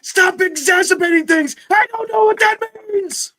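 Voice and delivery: high, loud comic yelling; movie quote shout. Retold or imitated. Reenacting the zombie movie for friends, loud high shout. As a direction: bellow it like a panicked movie hero.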